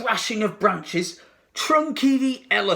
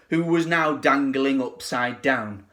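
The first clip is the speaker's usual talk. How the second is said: ordinary delivery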